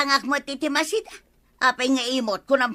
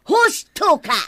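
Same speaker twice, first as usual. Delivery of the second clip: loud, higher snap of agreement; sharp retort; 7 semitones higher, 6 dB louder than usual. Acted. Wife snaps back at husband, loud and high. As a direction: fire it back at him.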